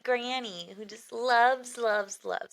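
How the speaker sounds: lightly voiced sweet granny; a sweet little granny voice, lightly done; Fran Drescher. Shown as a demonstration. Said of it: "Now she's Fran Drescher".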